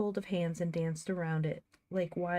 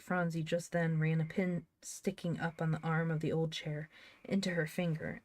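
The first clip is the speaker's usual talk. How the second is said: plain reading voice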